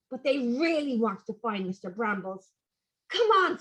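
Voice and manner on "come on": eager call. Acted. Tom urging sister to farm.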